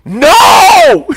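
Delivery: high, loud no; hammed-up yell; pretend shout. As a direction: shout it diving for the plate. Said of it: "I will dive over the table".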